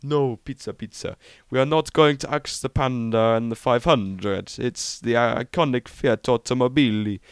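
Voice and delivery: bad mock Italian boss voice; hammed-up Italian accent. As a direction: badly fake an Italian accent. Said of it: "in an Italian accent. I can't really do it".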